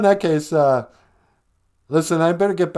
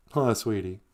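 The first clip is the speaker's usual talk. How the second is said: ordinary talk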